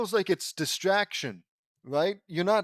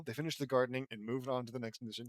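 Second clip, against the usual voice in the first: normal talking voice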